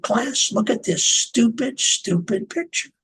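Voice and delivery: high-pitched imitation of a scolding teacher; mock a teacher shaming a pupil; scornful teacher. Teacher shows boy's picture to class, imitated scorn.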